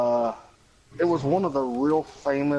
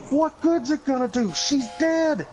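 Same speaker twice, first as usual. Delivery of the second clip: very high, hammed-up exasperation; a shrill mock caller fed up; exasperated; 13 semitones higher than usual. Caller snapping back at dispatcher, high hammed-up exasperation. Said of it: "She goes".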